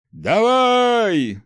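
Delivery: a full-throated roar; yelling. A narrator's reading. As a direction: bellow it with all your might. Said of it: "заорал он во всё горло".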